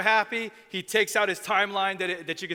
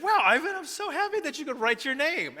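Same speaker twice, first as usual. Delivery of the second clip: excited praise; 10 semitones higher than usual. Retold or imitated. Mentor cheering a boy who wrote his name, high and delighted. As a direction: gush over a child's first written name.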